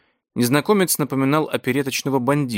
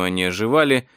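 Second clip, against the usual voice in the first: plain reading voice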